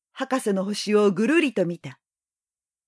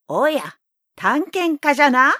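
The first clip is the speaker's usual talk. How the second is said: put-on louder old man exclamation; old man calling out; 6 semitones higher, 5 dB louder than usual. A narrator's reading. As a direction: call out to the visitor. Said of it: "その人は大声を上げた".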